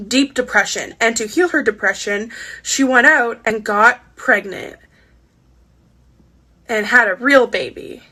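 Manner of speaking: usual speaking voice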